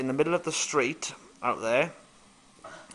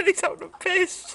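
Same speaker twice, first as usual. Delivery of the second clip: high laughing. Real, not acted. Laughing at Ryan.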